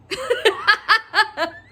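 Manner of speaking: high burst of real laughter. Real, not acted. Storyteller laughs at her own comeback, amused. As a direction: crack up at your own line.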